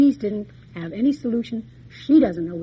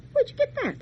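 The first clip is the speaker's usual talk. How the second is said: much higher, amazed question; surprised; faster than usual. Retold or imitated. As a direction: gasp it out in surprise. Sisters recognise the handkerchief.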